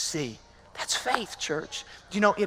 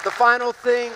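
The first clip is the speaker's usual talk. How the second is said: loud preaching; 5 semitones higher, 8 dB louder than usual. Real, not acted. Preacher builds to key point.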